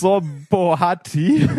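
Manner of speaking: Brzobohati said slowly, syllable by syllable, in Czech fashion; careful name pronunciation. Shown as a demonstration. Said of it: "der Name ist schön".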